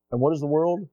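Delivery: normal talking voice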